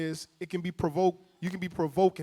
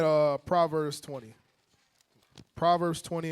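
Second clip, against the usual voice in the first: ordinary talk, nothing marked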